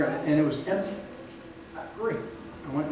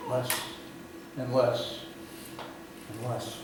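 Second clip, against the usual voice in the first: slow, dropping softer and lower; fading away; 4 semitones lower, 8 dB quieter, slower than usual. Real, not acted. Preacher warns God's word will matter less, fading away. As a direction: let each repeat sink further, with pauses between. Words act out diminishing.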